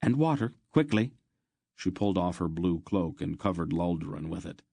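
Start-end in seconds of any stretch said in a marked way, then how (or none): 0.0-1.1 higher, pressing command; urgent instruction. A narrator's reading. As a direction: hurry him along. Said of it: "Aunt Paul instructed".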